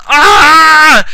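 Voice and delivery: long, high, loud mock death scream; hammy villain's dying yell. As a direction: scream out as if exploding. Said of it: "who yelled".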